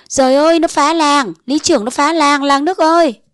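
high, loud wailing cry for help. A narrator's reading. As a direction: howl it to the whole village, pitched high. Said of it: "Liễu kêu ầm ĩ". (la ầm ĩ, giọng cao và to kêu cả làng)

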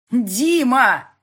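high, loud scream of his name. A narrator's reading. Heroine screams at her boss, desperate shout. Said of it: "заорала я".

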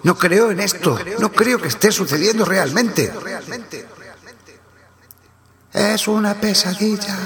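high, disbelieving fear; a stranger's voice unravelling; frightened voice. Acted. Unnamed crewman over the radio, refusing to believe, high frightened disbelief. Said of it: "dijo alguien".

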